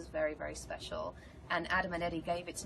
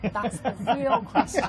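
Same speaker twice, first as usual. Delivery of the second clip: loud, higher, through real laughter; laughing; 4 semitones higher, 10 dB louder than usual. Real, not acted. Joking about being arrested for honesty, real laughing. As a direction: laugh hard while getting the words out.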